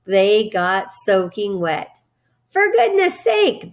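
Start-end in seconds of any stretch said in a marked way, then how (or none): 2.5-3.7 high, drawn-out exasperated cry; raised, scolding outburst; bellowed. A narrator's reading. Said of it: "bellowed Mister Zuckerman".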